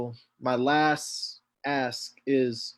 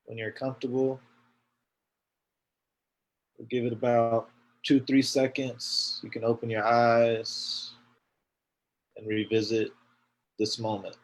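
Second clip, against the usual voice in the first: guided meditation; 2 semitones lower than usual. Acted. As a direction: ease them gently out of it. Closes the meditation.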